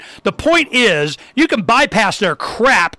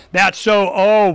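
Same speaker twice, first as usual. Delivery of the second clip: ordinary talk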